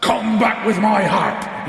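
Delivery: slow, high, loud roar; giant's roar. A narrator's reading. Giant chasing Jack for his harp, drawn-out roar. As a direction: roar every word out long. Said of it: "he roared".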